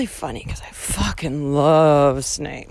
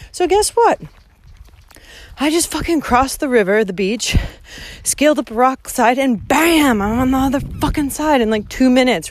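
bursting with the story of the shortcut; proud, fired-up storytelling; excited; 6 semitones higher than usual. Real, not acted. Excited retelling of crossing.

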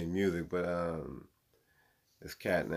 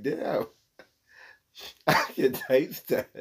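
quoting the skit; 5 semitones higher, 5 dB louder, slower than usual. Retold or imitated. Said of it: "He's like".

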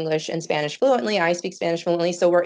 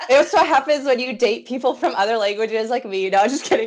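teasing joke said through laughter, higher; laughing joke; 6 semitones higher, 4 dB louder than usual. Real, not acted. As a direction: crack the joke while laughing. Joking about her own boyfriend.

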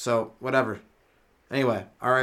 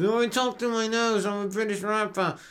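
high, chanted mock English rap through the nose; hammed-up English MC, all nose; nasal English rapper; 8 semitones higher than usual. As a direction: rap like a nasal British caricature. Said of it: "maybe there's an English rapper".

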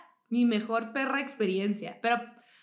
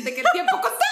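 higher and louder through laughter; laughing at the memory of being timed; 8 semitones higher, 7 dB louder, much faster than usual. Real, not acted. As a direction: squeal it out laughing. Laughing about timed shopping.

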